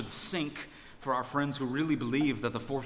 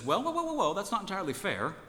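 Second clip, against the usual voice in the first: stammering well, well, well, pitched much higher; flustered husband; 8 semitones higher than usual. Retold or imitated. The husband defending himself to the pastor, mock flustered. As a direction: hedge and sputter in mock defence.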